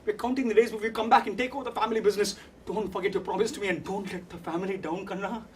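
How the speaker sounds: soft, worried motherly appeal; pleading mother. Acted. Mother reminding son of his promise, softly pleading.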